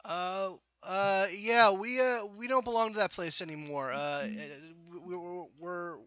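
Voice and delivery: high, stuttering, scared voice; a panicked tenant begging them to leave; nervous stammering. Acted. GM voices frightened resident.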